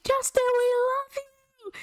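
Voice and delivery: high-pitched squealed fan cheer, no real volume; mock fan screaming. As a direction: squeal like an adoring friend in the crowd. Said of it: "screaming. And, like, I hear, like, my friends".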